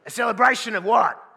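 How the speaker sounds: loud, high complaint; older man loudly objecting to the hair; indignant older guy. Retold or imitated. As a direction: blurt it out, outraged. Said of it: "they'll say something like this".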